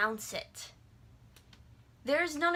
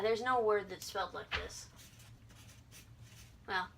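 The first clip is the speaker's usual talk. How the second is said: normal talking voice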